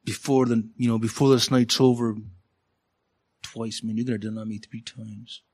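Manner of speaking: quiet, casual tell-off tossed out; offhand warning. Retold or imitated. Preacher trying out how Jesus warned Peter, offhand. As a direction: say it like a throwaway rebuke. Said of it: "How did he say it?".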